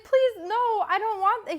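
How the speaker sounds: high, loud mock desperation; pleading refusal. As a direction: beg urgently to be left alone. Retelling protest at nose strip.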